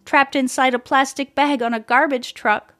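ordinary delivery

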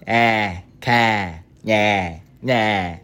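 mock Boston open "ah" vowels; exaggerated Boston bleating; Boston accent. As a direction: caricature Boston with dropped R's. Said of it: "they sound like fucking idiots".

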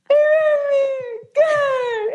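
high, loud mock sob on good; imitating crying. Friend imitates her crying on FaceTime, loud mock sob. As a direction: wail it like happy tears. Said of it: "And she's like".

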